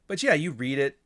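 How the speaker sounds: ordinary delivery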